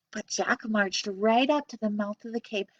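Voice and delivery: usual speaking voice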